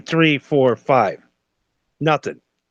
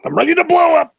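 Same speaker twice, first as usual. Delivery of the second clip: mock blow-up; 11 semitones higher, 4 dB louder, faster than usual. Venting about being pushed too far.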